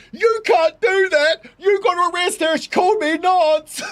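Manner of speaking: high, loud, slow mock whine; whiny outraged man. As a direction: wail like an offended crybaby. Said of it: "And the guy goes".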